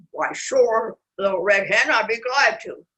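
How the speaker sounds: lively storybook reading; animated picture-book narration; reading to children. A narrator's reading. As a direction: tell it to little ones.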